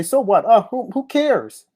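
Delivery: scoffing at what the laughers say, mocking shrug. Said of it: "people laugh about it and say".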